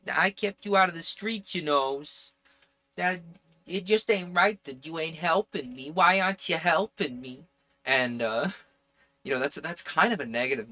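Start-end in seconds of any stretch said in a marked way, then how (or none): 0.1-2.3 higher-pitched put-on Jersey accent; attempted Jersey accent. Relative guilt-tripping their kin, in an attempted Jersey accent. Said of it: "I might be able to do a Jersey accent". 3.0-7.4 put-on higher, whining-relative Jersey accent; attempted Jersey accent. Relative asking why nobody helps, in an attempted Jersey accent. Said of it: "I might be able to do a Jersey accent".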